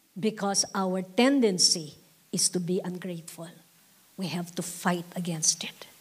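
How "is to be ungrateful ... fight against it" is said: whisper. Real, not acted. Preacher drops to whisper.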